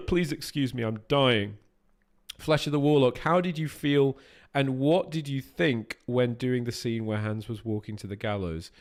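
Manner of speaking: usual speaking voice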